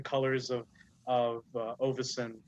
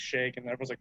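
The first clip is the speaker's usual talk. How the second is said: usual speaking voice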